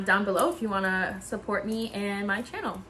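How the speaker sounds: ordinary talk, nothing marked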